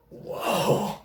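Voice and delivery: high, airy whoa; retold awe. Storyteller seeing how far the shaft flew, awestruck. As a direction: let out an amazed, barely voiced whoa.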